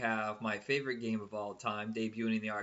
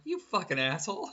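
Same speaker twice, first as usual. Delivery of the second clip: higher, louder, mock-scandalized insult to a friend; mock outrage; 7 semitones higher, 4 dB louder than usual. Retelling calling his friend a jerk over the dead pet, mock outrage. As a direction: call him out, half laughing, half appalled.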